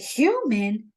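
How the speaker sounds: hammed-up indignant disbelief; comic mock anger, not shouted; mock mad. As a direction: play at being outraged, pitch leaping up then dropping. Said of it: "Triton gets all mad. He's like".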